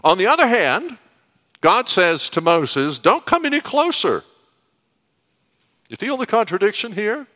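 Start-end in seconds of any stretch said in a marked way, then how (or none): 3.0-4.2 sharp high forbidding call; stern command. Acted. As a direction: push him back with a high warning. God forbidding approach.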